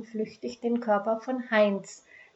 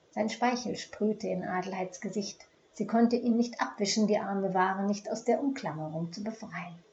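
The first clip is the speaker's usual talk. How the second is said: usual speaking voice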